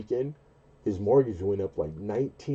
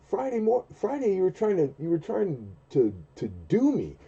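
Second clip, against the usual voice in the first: high, protesting reminder; mock indignant; 11 semitones higher than usual. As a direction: remind the boss what he tried. Retold confrontation.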